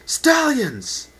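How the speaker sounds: a commander's full-throated bellow; roared. Acted. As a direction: roar it to the whole army. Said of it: "A powerful voice roared".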